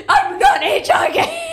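high, drawn-out mock shriek; retold screaming. Girlfriend refusing to hitchhike, mock screaming. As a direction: wail the refusal slowly, half laughing. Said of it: "we were, like, screaming".

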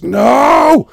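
mock amplified NO; shouted no. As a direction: yell it into the mic like the performer. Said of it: "And she goes".

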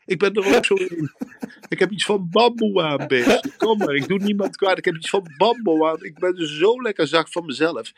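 high, laughing call to a wild beast; calling out to animals. Acted. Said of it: "riep ik". (hoog en lachend roepend naar een beest)

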